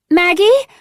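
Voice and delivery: high, loud, rising call through a door. A narrator's reading. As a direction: shout the name up, asking. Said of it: "she called".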